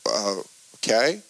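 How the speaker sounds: slow, low, hesitant okay with a rise; reluctant okay. Retold or imitated. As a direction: draw it out warily, as if unsure. Hesitant answer to God's prompting.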